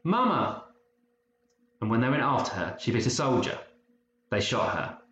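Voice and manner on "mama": high urgent calling out for Mama; shouting. A narrator's reading. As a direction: call out to mother in alarm. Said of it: "then shouted".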